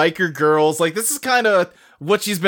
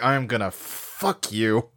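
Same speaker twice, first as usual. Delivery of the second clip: mock seductive growl; 9 semitones lower, 7 dB quieter, slower than usual. Seductive look retold.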